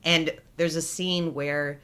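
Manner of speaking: usual speaking voice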